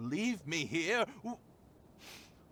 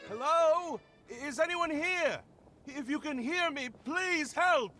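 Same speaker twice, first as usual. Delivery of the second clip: calling for help; 10 semitones higher than usual. Acted. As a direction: call out desperately to anyone who might hear. Trapped man calls out for help.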